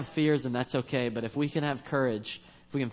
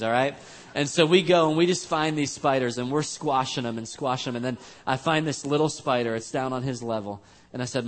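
ordinary talk, nothing marked

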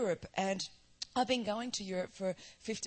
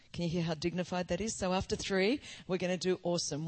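usual speaking voice

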